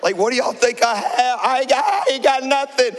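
loud, high defensive denial; guilty protest. Retold or imitated. Pastor nervous at airport security, loudly and guiltily denying. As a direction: protest your innocence too hard. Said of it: "which a guilty person would say".

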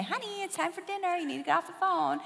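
high, fast sing-song mom calling; sweet mother calling her daughter off the phone; mom's voice. Retold or imitated. Mom calling her teen daughter to dinner, high and quick. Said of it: "she'd say".

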